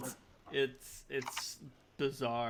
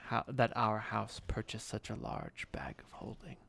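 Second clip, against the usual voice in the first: hushed warning; 6 semitones lower, 4 dB quieter than usual. Acted. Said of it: "takes you aside".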